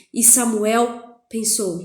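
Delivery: ordinary talk, nothing marked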